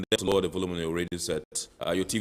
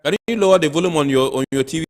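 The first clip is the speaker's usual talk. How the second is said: loud, higher voice over a noisy line; to someone hard of hearing; 9 semitones higher, 11 dB louder than usual. Real, not acted. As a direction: raise your voice to be heard. Host asking caller over noise.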